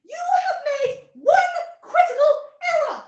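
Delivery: very high, loud, pompous accusation; shrieked courtroom condemnation; accusing alien judge. Acted. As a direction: proclaim the fault like an outraged judge.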